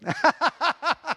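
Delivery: a loud high laugh; bright burst of laughter; real laugh. Real, not acted. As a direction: laugh along with the crowd. Laugh with congregation.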